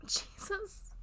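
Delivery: spent, whispered awe; breathless whisper. Acted. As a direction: barely voice it, exhausted. Afterglow whisper.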